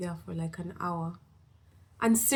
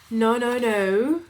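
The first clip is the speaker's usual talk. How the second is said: slow, loud, higher emphatic no; 5 semitones higher, 9 dB louder, slower than usual. Real, not acted. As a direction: drag out each no firmly. Firmly refusing to wait.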